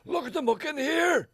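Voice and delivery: high, loud mock holler; hammed-up yelling; Gregory Campbell. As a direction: shout it like an irate farmer. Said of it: "for Gregory".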